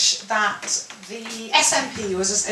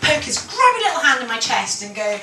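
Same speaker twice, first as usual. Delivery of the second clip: normal talking voice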